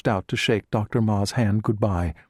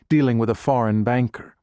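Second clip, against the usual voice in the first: ordinary delivery, nothing marked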